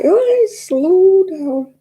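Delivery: mock plea, stretched out, high and loud; drawn-out plea. Telling how she begs fast-talking Irish sisters, drawn-out mock plea. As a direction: stretch each word right out in mock overwhelm.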